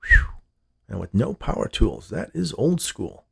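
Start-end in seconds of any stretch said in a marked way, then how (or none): 0.0-0.3 short high whistle of admiration; whistle, impressed; admiring whistle. A narrator's reading. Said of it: "The man whistled his admiration".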